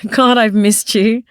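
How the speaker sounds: slow, heartfelt blurt. Acted. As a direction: let it slip out, sincere. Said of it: "I say out loud".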